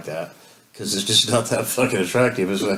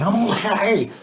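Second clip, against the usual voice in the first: much higher, imitated exasperated yell; retold yell; 11 semitones higher, much slower than usual. She yells at him to stop fussing, mock exasperated yell. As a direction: yell it back as her, fed up. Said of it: "she finally yelled at me".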